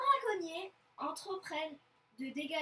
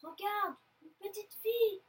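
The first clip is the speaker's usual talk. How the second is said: high, loud exclamation; eager, raised-voice discovery; excited call; 6 semitones higher, 7 dB louder than usual. Acted. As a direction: point out a find excitedly. Excited spotting of prey.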